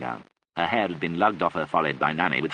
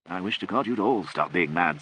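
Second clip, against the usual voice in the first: ordinary delivery, nothing marked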